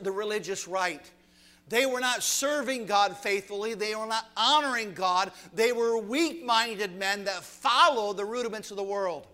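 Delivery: loud, rising sermon exhortation; preacher calling on the young men, full-voiced and rising; forceful preaching. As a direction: drive it home to the young men.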